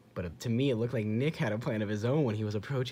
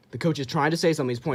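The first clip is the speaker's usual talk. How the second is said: excited rush; 5 semitones higher, 7 dB louder, much faster than usual. Real, not acted. Excited commentary on replay.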